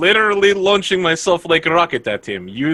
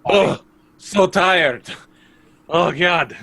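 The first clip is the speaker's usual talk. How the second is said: mock exhausted moan; 4 semitones higher, slower than usual. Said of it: "I'm literally just like".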